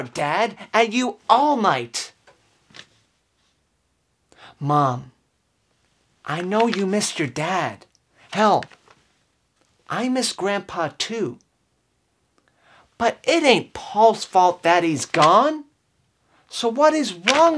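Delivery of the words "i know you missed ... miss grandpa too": ordinary talk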